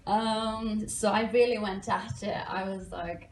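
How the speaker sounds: ordinary talk, nothing marked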